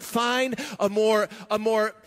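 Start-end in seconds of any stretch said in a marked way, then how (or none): none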